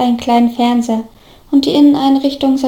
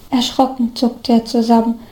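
ordinary delivery

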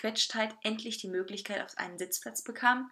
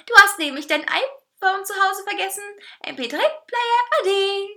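high, loud teasing cake voice; sing-song high taunt; gloating; 11 semitones higher, 9 dB louder than usual. A narrator's reading. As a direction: rub it in, drawing out the goodbye. Cake gloats over forgotten phone.

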